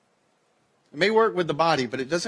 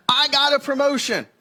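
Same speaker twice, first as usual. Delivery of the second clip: bright, high, thrilled news; excited announcement; 10 semitones higher, 4 dB louder than usual. Acted. Partner coming home, sharing big news excitedly. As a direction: burst out with happy news. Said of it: "comes home and they say".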